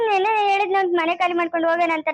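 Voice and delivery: ordinary talk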